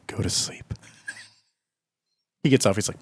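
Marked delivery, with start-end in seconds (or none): 0.1-0.6 whispered lullaby hush; whispering over a crib. Pretend. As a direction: hush him to sleep in a whisper. Hushing him to sleep, whispered.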